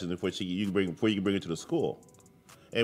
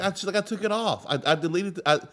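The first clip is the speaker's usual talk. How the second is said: wife's excuse; 7 semitones higher than usual. Retold or imitated.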